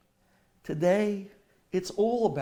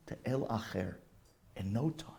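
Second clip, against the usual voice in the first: ordinary talk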